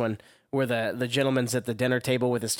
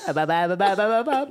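mock chant; 7 semitones higher, 5 dB louder than usual. Quoting PSA parody.

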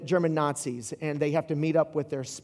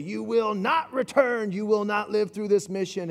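stern commander; 4 semitones higher than usual. Retold or imitated. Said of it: "and says".

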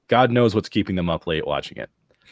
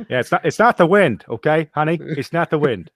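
mock reassuring husband; 6 semitones higher, 4 dB louder than usual.

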